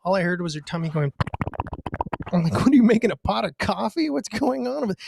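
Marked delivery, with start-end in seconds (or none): 2.5-4.9 high teasing questions; high mocking needle; mock nosy question. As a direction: poke fun at her, pitched up. Mocking wife in bathroom.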